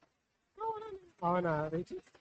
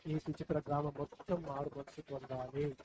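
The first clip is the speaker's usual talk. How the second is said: put-on slow, softer prayer line for the child to repeat; leading a prayer; 6 semitones lower, 8 dB quieter, slower than usual. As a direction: pray it slowly so the child can follow. Adult leads prayer. (నెమ్మదిగా, మెత్తగా ప్రార్థన)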